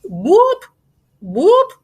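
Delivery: high whooping siren call, twice; siren imitation. Imitating the feds' siren as the car pulls out. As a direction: mimic a police siren going off. Police siren pulling the Benz over.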